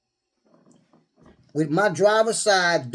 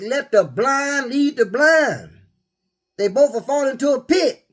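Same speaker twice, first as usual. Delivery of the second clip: preachy quote; 7 semitones higher, 4 dB louder, slower than usual. Real, not acted. Quoting the Bible forcefully.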